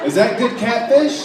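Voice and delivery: loud, high, drawn-out bewilderment; baffled question. Acted. Confused customer asking about the catfish, loud high bewildered voice. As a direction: ask it in high-pitched disbelief.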